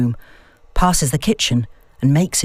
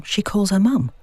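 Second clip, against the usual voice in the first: ordinary delivery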